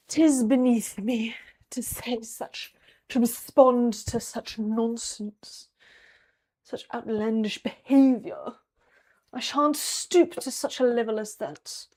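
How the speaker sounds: loftily disdainful, in mock old-fashioned grandeur; mock haughty. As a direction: play someone too grand to respond, drawing it out. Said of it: "They're like, "No, I'm above this".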